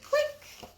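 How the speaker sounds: camera-click voice; high click. A narrator's reading. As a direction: snap it like a camera. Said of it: "Cam closed her eyes and said".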